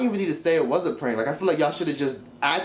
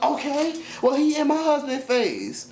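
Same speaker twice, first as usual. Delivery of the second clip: much higher, snippy retort; mocking Bianca; 12 semitones higher, slower than usual. Vlogger mimicking Bianca's comeback, high and snippy. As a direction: snap back in a high mocking voice. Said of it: "Bianca was like".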